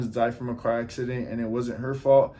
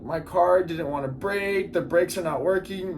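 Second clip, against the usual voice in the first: mock hysterical crying; 5 semitones higher than usual. Driver blaming her car after the crash, mock hysterical crying. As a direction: whine it higher, like someone crying. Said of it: "she starts hysterically crying and crying".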